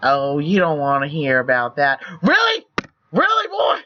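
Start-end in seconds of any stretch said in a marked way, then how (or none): 2.2-3.8 high-pitched, rising disbelief; incredulous. Real, not acted. Reader scolding the evasive character, incredulous. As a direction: call him out in a much higher voice.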